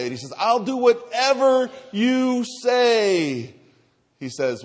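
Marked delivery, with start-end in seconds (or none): 0.4-3.5 high, loud, drawn-out plea; desperate promise. Acted. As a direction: cry out frantically, stretching the words. Hanging man begs God.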